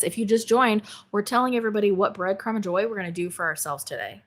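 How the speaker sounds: ordinary talk, nothing marked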